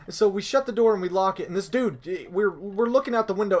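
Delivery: normal talking voice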